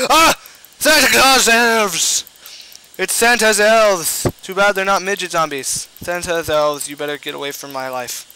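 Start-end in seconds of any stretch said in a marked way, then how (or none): 0.1-4.3 high, loud, slow alarm; panicked yelling. Real, not acted. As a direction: shout the alarm. Panics at elves appearing.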